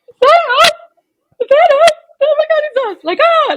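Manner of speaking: very high, tearful squeal of disbelief; crying with joy. Retold or imitated. Winning the world title, crying out in tearful disbelief. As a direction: squeal it out like you just heard your name called. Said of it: "I just start crying".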